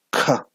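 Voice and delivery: "K" with a strong burst of breath; aspirated k. Shown as a demonstration. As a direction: breathe out hard on "K". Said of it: "Sonido aspirado". ("K" aspirada)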